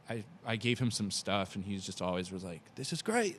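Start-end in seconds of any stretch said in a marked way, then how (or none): 2.7-3.4 high-pitched imitation of an Australian accent; attempted Australian accent. Quoting a friend's reaction to gifts, attempted Australian accent. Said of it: "if that's how he sounds".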